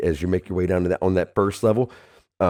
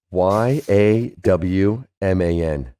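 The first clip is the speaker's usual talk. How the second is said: spelled out letter by letter; 5 dB louder, slower than usual. Shown as a demonstration. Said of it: "it is spelled".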